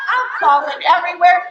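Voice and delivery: ordinary delivery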